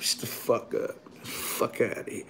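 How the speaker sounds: low, whispered brush-off; muttered dismissal. Retold or imitated. Inmate dismissing a man in the bullpen, muttered. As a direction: dismiss him under your breath, very softly.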